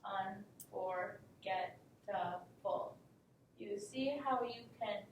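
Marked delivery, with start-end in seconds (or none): 0.1-3.0 slow and lower, every syllable set apart; paced out syllables. Shown as a demonstration. Teacher paces out the word for the class, slowly by syllable. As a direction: clap it out one syllable at a time. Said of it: "pace out the sounds with your hands".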